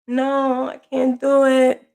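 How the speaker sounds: a lightly voiced tearful boy, slow; child's tearful reply. A hint of the son's tearful refusal to his mother. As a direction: mimic the son's small upset protest. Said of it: "when he got teary-eyed".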